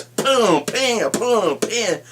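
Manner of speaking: high, loud boom bam sound effects; excited mock impact sounds; smash noises. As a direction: bang out the hits like a kid. Imitating Hulk smashing Loki.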